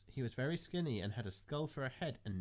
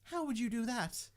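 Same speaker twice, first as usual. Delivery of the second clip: coy high voice; 12 semitones higher than usual. Acted. Coy question.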